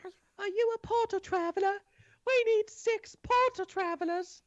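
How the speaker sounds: very high, eager squeaking; squeaky mice voices. Acted. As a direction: pipe up like a swarm of mice. Mice pester everyone in bar.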